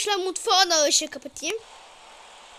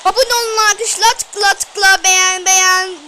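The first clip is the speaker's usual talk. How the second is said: loud outro; 8 dB louder than usual. Real, not acted. Outro asking viewers to subscribe.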